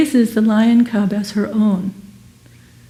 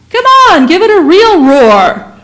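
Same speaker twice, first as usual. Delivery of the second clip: much louder, higher coaxing lion call; urging lion; 8 semitones higher, 6 dB louder than usual. Acted. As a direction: push the cub to roar harder. Lion urging another try.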